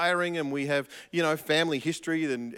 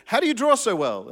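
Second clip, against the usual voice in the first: eager cartoon question; 6 semitones higher, 7 dB louder than usual. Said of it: "it says".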